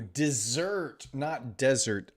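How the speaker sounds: ordinary talk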